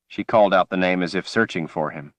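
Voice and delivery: ordinary delivery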